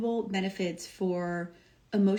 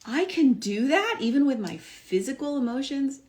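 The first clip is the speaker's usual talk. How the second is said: higher and louder, amazed question; surprised disbelief; 6 semitones higher, 5 dB louder than usual. Retold or imitated. Hearing the coach's advice, answering in high, loud disbelief. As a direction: act stunned at the idea. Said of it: "And I was like".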